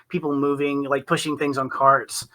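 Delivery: ordinary talk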